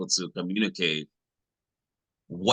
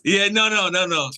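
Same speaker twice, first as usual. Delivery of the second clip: excited denial; 12 semitones higher, 6 dB louder than usual. Real, not acted. Laughing denial to the group.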